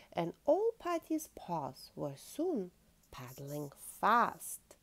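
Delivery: ordinary delivery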